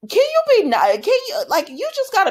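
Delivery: high, loud exasperated plea; exasperated outcry. Real, not acted. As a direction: cry out at the screen in disbelief. Exasperated at Nicholas on screen.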